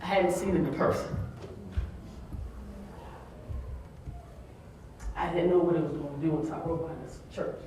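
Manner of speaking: quiet, choked up; fighting tears. Real, not acted. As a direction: hold back tears as you speak. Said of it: "I'm gonna cry".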